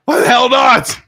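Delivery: high, loud outraged shout; retold angry yell; pissed-off retort. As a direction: act out exploding at being refused. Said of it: "So I get pissed".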